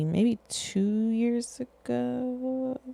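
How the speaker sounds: unsure recall. Real, not acted. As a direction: stretch it out while counting back the years. Searching memory for the year.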